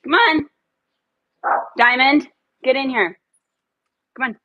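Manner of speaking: loud, high call to come in; calling dogs. Real, not acted. Owner calling Diamond to get inside, loud and high. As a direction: hurry the dogs in from outside.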